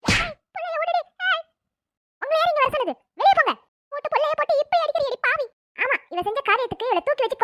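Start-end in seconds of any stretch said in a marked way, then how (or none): 0.0-5.5 high, loud angry outburst at her daughter; furious scolding. Acted. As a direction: storm in and stop her. Grandmother stops the beating.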